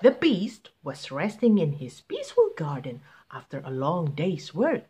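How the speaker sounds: ordinary delivery, nothing marked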